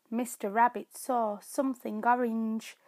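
ordinary delivery

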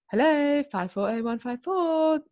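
high, slow singsong phone greeting; answering parents' phone. Retold or imitated. Imitating friends answering parents' phone, high recital. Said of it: "when they answered their parents' phone".

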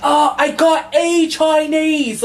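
high, slow British sneer, mocking; mocking British accent. Repeating British TikTokers' phrase in a British accent, high and slow. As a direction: drawl it out in a mock British accent. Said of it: "And they say".

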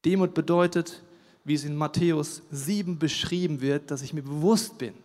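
ordinary talk, nothing marked

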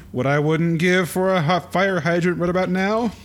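a hint of a cocky cartoon hero; Nestor voice. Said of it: "And Nestor says".

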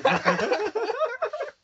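high rising laugh. Real, not acted. Telling his friends about marching the wrong way, high laughing. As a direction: laugh at yourself.

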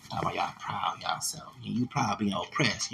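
hushed, mocking whisper; a scornful aside, whispered. Real, not acted. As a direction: sneer it under your breath.

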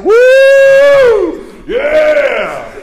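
high loud woo and yeah; shouting like the old man up there; excited shout. Retold or imitated. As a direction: whoop like a fired-up old man. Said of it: "Just like the old man up there".

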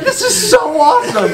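laughing delight. Retold or imitated. Architect thrilled, laughing.

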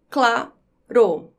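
"claro" split into cla, ro, with a pause; syllable by syllable. Shown as a demonstration. Teacher showing where the word divides, syllable by syllable. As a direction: say "claro" as two detached syllables. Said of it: "quando eu separo as sílabas".

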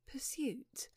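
a startled breathed echo; startled whisper. Acted. Trevor startled by the word, whispered. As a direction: catch your breath on it. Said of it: "could not help starting".

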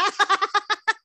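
evil witch laugh; high, cackling witch laugh; witch cackle. Acted. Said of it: "me tenía que salir mi risa de bruja".

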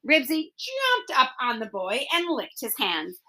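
ordinary delivery, nothing marked

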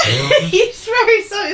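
laughing. Real, not acted. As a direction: crack up while saying it. Laughing at the absurd story.

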